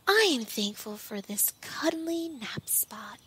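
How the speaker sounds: drowsy, whispered and soft; sleepy whisper. Acted. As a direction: murmur it half asleep. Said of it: "Pearl says sleepily".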